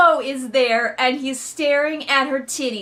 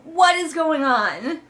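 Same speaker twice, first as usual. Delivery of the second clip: exasperated; 6 semitones higher, 3 dB louder than usual. Real, not acted. Reviewer baffled by the scene.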